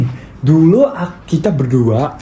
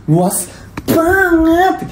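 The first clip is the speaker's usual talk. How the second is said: gushing; much slower than usual. Real, not acted. Gushing over room.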